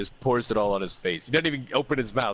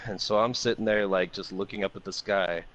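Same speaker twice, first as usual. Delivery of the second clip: ordinary delivery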